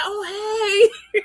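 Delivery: high, startled reply; a hint of an old man caught off guard; imitating surprised old man. Elder man surprised by the girl's greeting, startled. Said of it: "And he was like".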